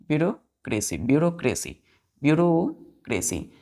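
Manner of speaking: ब्यूरोक्रेसी said as byu-ro-kre-si, slowing on the last take; pronunciation drill. Shown as a demonstration. Teacher drilling ब्यूरोक्रेसी as byu-ro-kre-si for pupils. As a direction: repeat ब्यूरोक्रेसी with the e kept silent. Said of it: "pronunciation पे ध्यान दीजिएगा".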